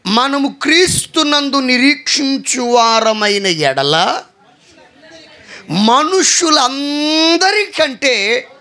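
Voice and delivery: very slow, high, loud preaching cry; impassioned scripture reading. Acted. Preacher reading the verse to the church, slow impassioned cry. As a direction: draw out each word, pleading.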